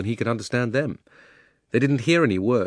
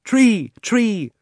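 very high, loud calls; chorus it in raised cries; a dozen animal voices; 12 semitones higher, 6 dB louder than usual. A narrator's reading. Animals voting on what it is, high eager calls. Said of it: "said a dozen voices".

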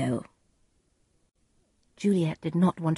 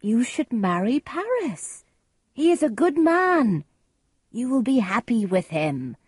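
higher-pitched, insistent advice; advising nurse; 7 semitones higher than usual. A narrator's reading. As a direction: urge it emphatically, pitch lifting.

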